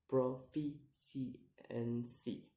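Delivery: "proficiency" slowly split into syllables; slow, separated syllables on "proficiency". Shown as a demonstration. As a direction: sound out "proficiency" piece by piece. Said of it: "count the word syllable backwards".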